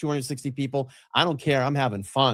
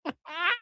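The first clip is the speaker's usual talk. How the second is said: laugh; 7 dB quieter than usual. Real, not acted. Laughs at played clip.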